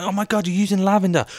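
outraged squeal at the telly; shocked TV viewer. Retold or imitated. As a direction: gasp at the contestant's mistake. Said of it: "I'll watch Bake Off and I'll be like".

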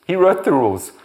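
high, loud punchline; loud, high declaration; emphatic. Acted. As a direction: nail it with force. Climax of sermon point.

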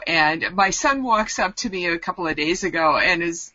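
ordinary talk, nothing marked

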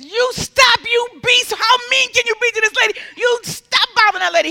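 high, loud acted outrage; outraged rebuke. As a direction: berate the healer for cruelty. Audience man rebukes healer.

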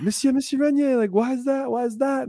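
high, pleading student voice; whiny kid. Retold or imitated. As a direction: beg the teacher for an answer. Students pester the teacher.

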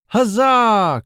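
high, loud, drawn-out battle cheer; cried cheer. A narrator's reading. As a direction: cheer like a knight raising a sword. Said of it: "cried the knight".

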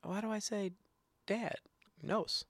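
daughter's voice. Retold or imitated. Daughter asking dad why she says it, high imitated child voice. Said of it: "one day my daughter said".

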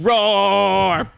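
loud, high, drawn-out mock roar; lion roar. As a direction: roar it out as a word. Lion corrects himself with roar.

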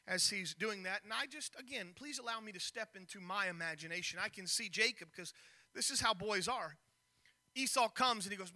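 ordinary delivery

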